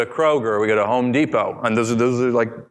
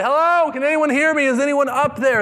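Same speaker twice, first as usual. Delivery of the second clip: crying out for help; 14 semitones higher than usual. A narrator's reading. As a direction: call up from the branch, desperate. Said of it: "he began to cry out".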